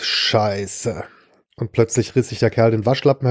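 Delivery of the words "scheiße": a low, muttered swear of annoyance; annoyed curse. A narrator's reading. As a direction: hitman curses under his breath at the empty gun, annoyed. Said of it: "Mir entfuhr ein genervtes".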